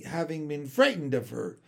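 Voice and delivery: usual speaking voice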